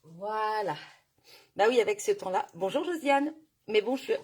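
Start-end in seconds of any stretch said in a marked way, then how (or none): none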